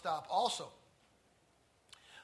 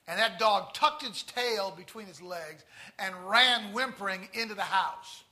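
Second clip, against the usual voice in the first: usual speaking voice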